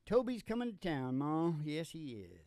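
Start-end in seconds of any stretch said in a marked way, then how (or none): none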